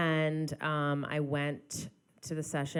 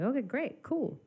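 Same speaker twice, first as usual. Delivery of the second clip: mock eager agreement; 10 semitones higher than usual. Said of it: "I was like".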